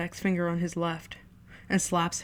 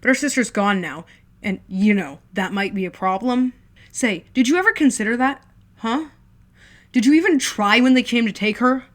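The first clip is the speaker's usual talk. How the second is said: loud, mocking, rising sneer; sarcastic older brother building to a shout; 4 semitones higher, 10 dB louder than usual. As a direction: taunt him harder each line. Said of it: "voice deep and sarcastic".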